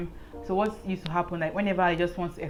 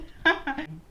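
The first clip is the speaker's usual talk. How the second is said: nervous laugh; 10 semitones higher than usual. Real, not acted. Laughs after the fright.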